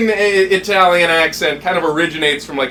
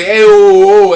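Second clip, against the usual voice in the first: Brooklyn Italian "Ayo, oh" called out louder; mock southern Italian; 4 semitones higher, 8 dB louder, slower than usual. Shown as a demonstration. Host demoing southern Italians' greeting in a mock Brooklyn Italian accent. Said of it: "there's two types of Italians".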